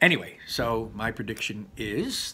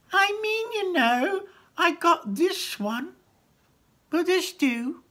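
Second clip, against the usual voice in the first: very high, slow goblin voice; goblin shopkeeper; 4 dB louder, slower than usual. Acted. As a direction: offer it slowly, high and casual. Goblin offering sword.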